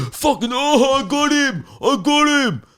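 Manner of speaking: loud mock braying of boys roughhousing at a kebab shop; rowdy private school lads. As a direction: crow like a boisterous schoolboy landing a hit. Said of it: "They're going".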